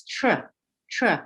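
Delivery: tr said as a bare blended sound; sound isolation. Shown as a demonstration. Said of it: "Let's try and say all the sounds".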